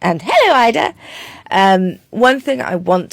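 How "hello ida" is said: high, bright-voiced hello to her horse; sweet greeting to the pony. Real, not acted.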